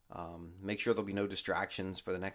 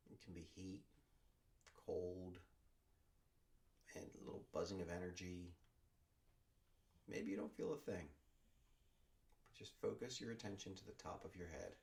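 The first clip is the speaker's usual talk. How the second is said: guided meditation; 2 semitones lower, 7 dB quieter than usual. Acted. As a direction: ease them down into stillness, word by word. Leads a body scan meditation.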